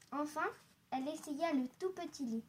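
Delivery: usual speaking voice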